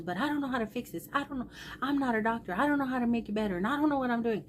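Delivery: high, rushed imitation of a helpless son; retold panicked yelling. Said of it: "he's yelling".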